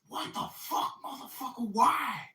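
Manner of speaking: high, squeaky mock outrage; a high put-on voice cursing in shock; Tyrone's angry outburst. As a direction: shriek it like an insulted worker. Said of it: "And he said".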